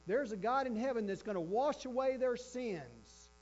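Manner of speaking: high, loud sermon crescendo; impassioned preaching. Real, not acted. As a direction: proclaim it with full pulpit force. Preaches at full force.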